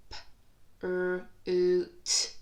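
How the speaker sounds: F, l, oo, t each said alone with a loud oo; sound-by-sound. Shown as a demonstration. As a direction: tap out each sound on a finger. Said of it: "four sounds in this word".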